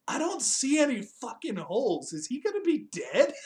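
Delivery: high, swooping mock-alarmed questioning; incredulous reaction. Viewer reacting to the boxed actor, mock incredulous. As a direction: pitch it up in comic disbelief. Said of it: "I was like".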